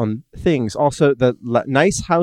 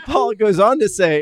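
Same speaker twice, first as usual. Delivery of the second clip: laughing; 8 semitones higher than usual. Real, not acted. Trying to read through laughter.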